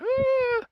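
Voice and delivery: high, drawn-out squeal; shriek. Pretend. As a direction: squeal like that guy. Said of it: "I would be the guy".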